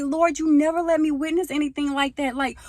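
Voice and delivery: high, loud mock wail; re-enacted high-pitched protest; upset complaint to God. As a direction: plead loudly with the Lord, exasperated. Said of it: "it made me even more sad".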